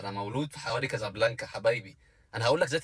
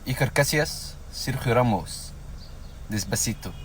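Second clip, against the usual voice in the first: mock Spanish; 3 semitones lower than usual. Shown as a demonstration. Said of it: "اسمعي".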